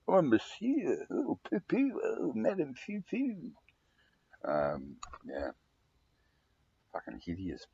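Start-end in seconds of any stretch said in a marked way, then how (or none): 0.1-3.5 high, drawn-out caricature Frenchman; mock French accent. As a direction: put on a silly, effeminate French voice, pitched way up. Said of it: "speak like a Frenchman".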